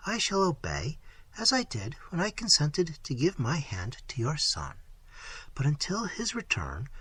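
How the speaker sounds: put-on soft, hushed young lady; a frightened girl answering carefully, half whispered; Isabella, timid and soft.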